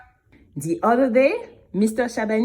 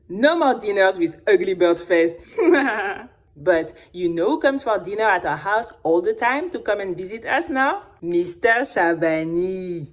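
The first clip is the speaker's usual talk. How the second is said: plain reading voice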